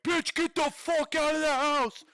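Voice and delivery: loud, high, slow frantic yell; yelling at the screen. Retold or imitated. Viewer yelling at the girl in the horror film, loud high frantic warning. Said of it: "and you're like".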